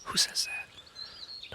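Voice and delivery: slow, hushed whisper. Real, not acted. Co-host questioning the host's confession, whispered. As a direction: ask it under your breath. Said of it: "Whisper now".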